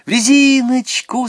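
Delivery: put-on high, loud girlish call; little girl calling out her pick; eager schoolgirl. A narrator's reading. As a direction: pipe up eagerly, stretching the word. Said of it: "сказала Наташа".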